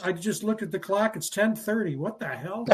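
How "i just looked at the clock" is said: ordinary delivery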